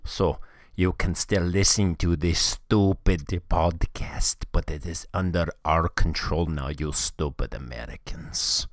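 hammed-up Russian accent, sneering; [name]'s bad Russian accent. Russian hacker sneers at American listeners, in a hammed-up Russian accent. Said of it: "I come up with this accent".

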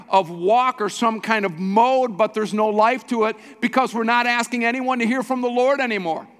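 high, forceful, building sermon; impassioned preaching. Real, not acted. Pastor decrying faith offered without repentance, impassioned preaching.

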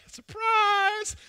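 high, loud shout of surprise; spring it on her like a proud kid; beaming ta-da of a husband showing off; excited surprise. Retold or imitated.

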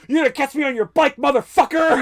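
loud, high, fast gloating shout; taunting yell. Retold or imitated. Don in his car taunts Vic on the bike, yelling. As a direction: yell it in rising triumph.

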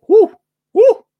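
high loud whoop; cheering woo. Real, not acted.

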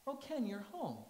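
lighter replay of mom's fake surprise; mom's mock surprise, toned down. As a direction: greet him as if unexpected. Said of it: "she would act surprised".

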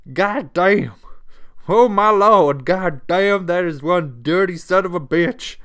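hammed-up Southern exclamation at filthy drain; loud Southern twang, amazed at the grime. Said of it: "I just turned on the, the southern twang".

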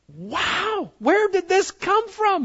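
high, wide-eyed wonder; amazed wow. Pretend. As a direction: gasp at the morning like a child. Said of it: "you go".

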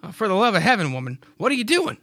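Clyde's voice. Acted. Clyde startled to find Mary working the field. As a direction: scold her in surprise. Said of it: "So Clyde says".

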